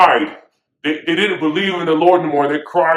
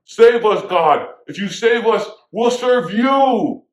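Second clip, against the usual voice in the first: pleading cry, high, slow at the end; crying out to God; 8 semitones higher, slower than usual. Retold or imitated. Samuel mimics the people crying to God, pleading. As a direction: beg God for rescue, drawn out. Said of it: "And you cried to God".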